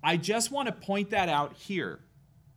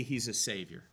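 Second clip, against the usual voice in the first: ordinary delivery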